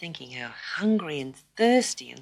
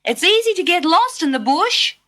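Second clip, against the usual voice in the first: mother's warning; 12 semitones higher, 5 dB louder than usual. A narrator's reading.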